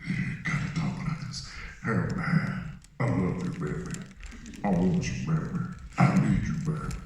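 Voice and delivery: low, deep crooning singer; big deep singer voice. Retold or imitated. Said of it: "had this big, deep voice".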